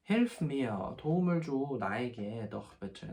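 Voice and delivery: ordinary talk, nothing marked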